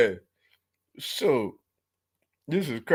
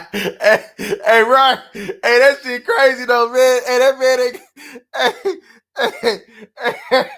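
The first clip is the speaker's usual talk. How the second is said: laughing while talking; 8 semitones higher, 8 dB louder, slower than usual. Real, not acted. Laughing at rival fans' misfortune.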